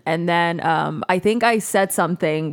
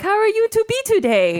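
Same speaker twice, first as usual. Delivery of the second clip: much higher, sweet voice in a put-on broken English; Mexican girl persona. Posing as Lolita, chatting up her crush, in a much higher voice. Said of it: "in my broken English, because she was from Mexico".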